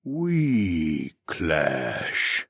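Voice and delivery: drawn-out words, falling away; slow slothman drone. A narrator's reading. Slothman admitting trouble with his brother, slow drone. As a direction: stretch each word out like a slothman.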